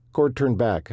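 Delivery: ordinary delivery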